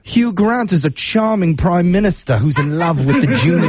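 hammy fake British accent. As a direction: lay on a bad British accent. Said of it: "use my absolute worst British accent".

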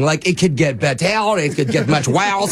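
hammed-up cartoonish French accent. Prank caller showing how the French flu comes and goes, French accent. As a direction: lurch into a broad mock French accent. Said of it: "now your voice is normal".

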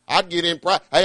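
ordinary talk

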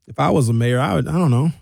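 usual speaking voice

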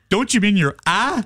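put-on Southern accent correction. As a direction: correct them with a Southern twang on "eye". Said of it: "She lost her Southern accent".

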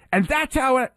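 imitating a sudden high-pitched rise; high, eager interviewer's question; voice shooting up. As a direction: pitch it up like a fastball. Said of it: "his voice goes up like a fastball pitch".

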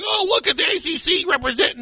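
high, smug fan voice; mock fan cheer. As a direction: sneer like a gloating fan. Mocking Louisville fans cheering.

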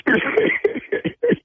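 shrieking high laugh at the reveal; high cackling laugh. Real, not acted. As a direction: cackle high at your own prank.

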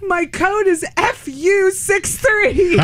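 high, slow, strangled squeal; reenacted tearful squeak; crying with laughter. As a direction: drag the code out through helpless giggles. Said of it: "I'm still crying".

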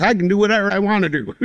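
quick, high imitation of a defiant wife; lightly hammed-up retort; mock defiant. Retold quarrel with wife over cursing.

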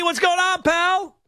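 usual speaking voice

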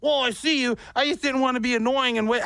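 a hint of a sheepish excuse; coworker's voice. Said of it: "switched up his voice".

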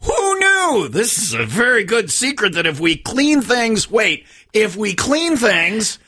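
high, slow sarcastic disbelief; mock astonishment. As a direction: ham up the shocked discovery. Mock shock at obvious tip.

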